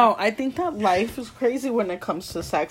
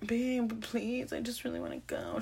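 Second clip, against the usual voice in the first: soft pleading; 12 dB quieter than usual. Acted. Friend begging a sleeping partner for a ride, soft mock pleading. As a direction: beg quietly, as if waking someone.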